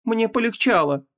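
high woman's voice, grimly dry; gloomy. A narrator's reading. Wife answering that she is dead, gloomy. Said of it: "мрачно ответила Ванесса".

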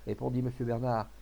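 usual speaking voice

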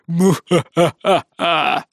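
медленный злодейский хохот; смеяться как злодей; villain laugh. Acted. Злодейский смех отца. (злодейский хохот «муахаха»)

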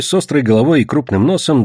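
plain reading voice

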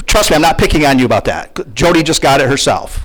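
ordinary talk

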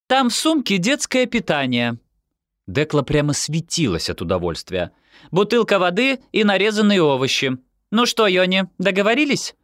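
high-pitched, gleeful mother's voice; delighted Dekla. Acted. Dekla hands over the baby bag, glowing with pleasure. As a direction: rattle off instructions high and pleased.

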